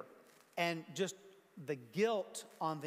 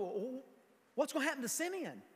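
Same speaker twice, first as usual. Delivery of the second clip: imagined concerned question; 6 semitones higher than usual. Retold or imitated. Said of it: "nobody's going".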